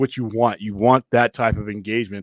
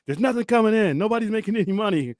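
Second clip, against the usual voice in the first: higher-pitched imitation of a film argument; retold arguing; 9 semitones higher than usual. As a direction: quote a frustrated adviser pleading his case. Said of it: "when he was arguing about Sonny".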